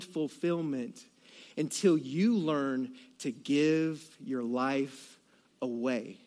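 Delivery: slow, hushed conviction; slow, soft sermon point; soft, slow and earnest. Real, not acted. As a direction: let it sink in gently, slowly. Preacher's tender closing point.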